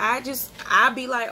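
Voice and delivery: ordinary delivery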